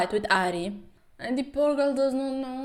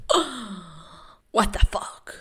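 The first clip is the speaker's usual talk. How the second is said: mock gasp; slower than usual. Character gasps at shoes.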